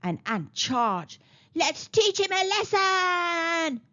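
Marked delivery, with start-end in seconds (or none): 1.5-3.8 high, drawn-out rallying shout; roaring battle cry. A narrator's reading. Ants charging at the boy, long roar. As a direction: roar it slowly, stretching the last word. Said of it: "the ants roared".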